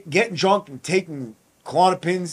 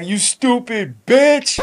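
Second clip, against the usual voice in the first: higher, slow insult yelled at the nurse; retold yell; 8 semitones higher, 3 dB louder, much slower than usual. Inmate yelling at the nurse who cut his pills, slow furious yell. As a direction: drag out an angry yell at her, stupid bitch stretched. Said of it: "I yell at her".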